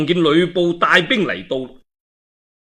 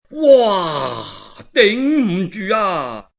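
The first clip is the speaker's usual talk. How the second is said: slow, wailing cry of defeat; panicked cry; 4 semitones higher, much slower than usual. Acted. Routed Cao soldiers crying out as Lü Bu charges, wailing. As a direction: wail that you can't hold the line.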